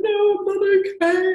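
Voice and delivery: high, loud, tearful cry of despair; choked-up plea, voice breaking high; breaking into tears. Retold or imitated. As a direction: let the voice crack as you start crying. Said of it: "And I started to cry".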